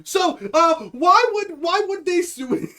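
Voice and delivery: high, halting stammer; hammed-up stunned questioning, stretched at the end; shocked. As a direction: play a flustered official caught off guard. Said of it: "they always look so shocked".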